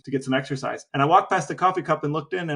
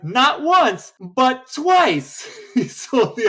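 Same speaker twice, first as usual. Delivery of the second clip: laughing delight; 10 semitones higher, 4 dB louder, much slower than usual. Real, not acted. Gleeful punchline about the con.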